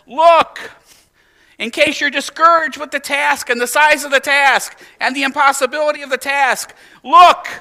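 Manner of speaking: high, loud urgent plea; Jesus urging discouraged disciples, high and loud; emphatic. Retold or imitated. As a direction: call out "look" to the discouraged. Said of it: "Jesus says".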